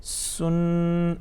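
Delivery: سن with a sharp whistled s; hard s, then a held n in سن; hard, whistling seen. Shown as a demonstration. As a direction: chant سن with a firm hiss at the start. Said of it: "سی ٹی والی آواز بھی آ جانی چاہیے".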